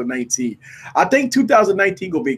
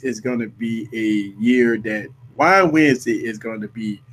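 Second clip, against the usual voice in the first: ordinary talk, nothing marked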